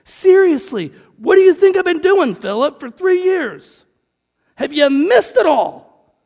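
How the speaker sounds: loud, high, exasperated rebuke; frustrated Jesus. Retold or imitated. Jesus scolding Philip for not knowing him, loud and exasperated. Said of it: "Jesus has gotta be frustrated".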